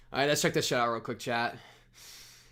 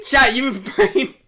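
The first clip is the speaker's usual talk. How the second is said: mock accusing; 11 semitones higher, 11 dB louder, much slower than usual. Real, not acted.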